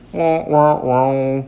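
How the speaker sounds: mouth-made wah-wah instrument; imitated organ sting. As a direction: mimic a sad comic musical sting. Imitating the organ's sad flourish.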